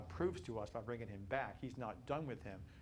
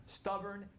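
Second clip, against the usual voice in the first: ordinary talk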